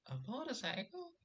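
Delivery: very high rising "A motorcycle?"; baffled girl. Retold or imitated. A girl confused by his punchline, high and puzzled. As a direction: mimic her puzzled squeak.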